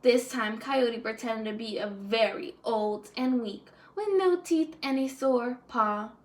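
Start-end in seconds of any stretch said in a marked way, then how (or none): none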